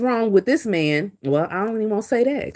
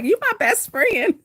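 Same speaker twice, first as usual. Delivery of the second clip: high, slow, cooing voice; teasing sing-song; 13 semitones higher than usual. Retold or imitated. Teasing her husband who stares at her, high slow coo. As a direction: coo it at him playfully. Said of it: "I'm like".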